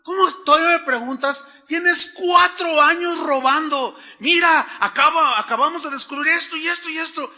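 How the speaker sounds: loud furious boss yelling at the employee; angry boss shouting. Retold or imitated. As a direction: bark it like a boss who caught a thief. Boss confronts thieving employee. (el jefe grita furioso al empleado)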